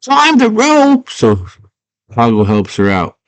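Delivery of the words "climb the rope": high, drawn-out mock yell; stretched-out high call of urging. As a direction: holler down a wall in a high voice. Hoggle yells down to Sarah.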